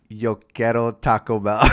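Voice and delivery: mock Spanish accent. Shown as a demonstration. Asked to say something in Spanish, he jokes in a Spanish accent. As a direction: toss off the ad line in a jokey Spanish accent. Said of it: "Say something in Spanish".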